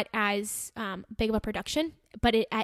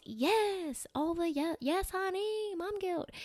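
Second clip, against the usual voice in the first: high, soft, sassy drawl; sassy honey voice; 7 semitones higher, 6 dB quieter, much slower than usual. Acted. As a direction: sass it up like a diva. Sassy aside to listener.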